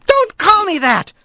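high-pitched, irritated retort; touchy magistrate telling off a colleague; indignant objection. Acted. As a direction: cut in crossly, voice jumping up high. Magistrate snaps at colleague over nickname.

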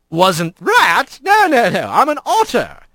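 genial, lilting denial; jovial otter mayor. Acted. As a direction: correct him cheerily, pitch lifted. Big otter's voice.

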